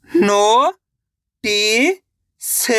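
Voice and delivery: अ, नो, खा drawn out separately, the first two rising; syllable by syllable. Shown as a demonstration. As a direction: sound out अ, नो, खा with long gaps. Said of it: "एक अक्षर का उच्चारण सही से". (अ, नो, खा अलग-अलग खींचकर)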